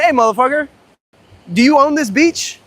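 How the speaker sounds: angry taunt at the stranger on the beach; angry challenge. Retold or imitated. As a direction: square up to him with the words. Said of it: "I was, like, ready to fight".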